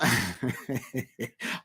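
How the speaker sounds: low, soft run of chuckles; soft, sheepish little laugh. Real, not acted. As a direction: chuckle quietly to yourself. Laughs at own rum remark.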